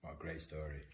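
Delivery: ordinary delivery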